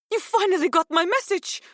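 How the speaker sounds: very high, loud, delighted cry; excited squeal of a Greek-accented woman; thrilled exclamation. A narrator's reading. Thrilled woman hears from awaited caller.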